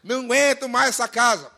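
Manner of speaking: loud, exasperated parody; mock complaining. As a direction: moan about it theatrically. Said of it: "tudo que você não reclamasse".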